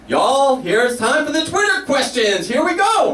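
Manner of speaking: big high-pitched host announcement, words stretched out; slow, high, excited host call; Oprah. Retold or imitated. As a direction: announce it to the crowd, rising high at the end. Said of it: "And Oprah's like".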